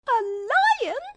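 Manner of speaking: high squeak of disbelief; alarmed mouse cry. A narrator's reading. Mouse queen hears of a lion, startled squeaky cry. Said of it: "cried the little queen".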